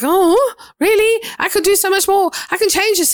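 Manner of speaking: light up at a sudden chance to fix things; eager excitement. Retold or imitated. Believer answering God about what she could do, excitedly. Acting out her eager reaction.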